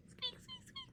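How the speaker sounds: very high, soft little squeaks; tiny squeak. Shown as a demonstration. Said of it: "sounds like a little squeak".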